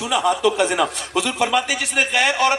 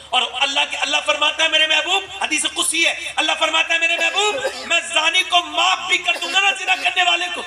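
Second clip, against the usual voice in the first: much higher, impassioned sermon cry; preaching at full force; 7 semitones higher than usual. Acted. Preacher quoting God's word to the crowd, impassioned. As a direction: rise to a pleading climax.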